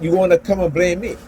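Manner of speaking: ordinary talk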